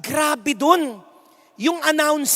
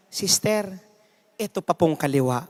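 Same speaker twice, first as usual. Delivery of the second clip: soft, lower, humble request; a hint of meek piety, softer and lower; meek offer; 6 semitones lower, 5 dB quieter than usual. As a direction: offer the other cheek sweetly. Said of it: "sasabihin niya".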